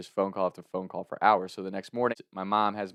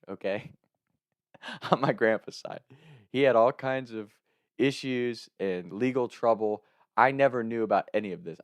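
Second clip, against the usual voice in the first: usual speaking voice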